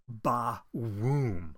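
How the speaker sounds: ba-woom stretched slow and booming; drawn-out explosion. Shown as a demonstration. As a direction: say ba-woom like a slow cartoon blast. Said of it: "I mean".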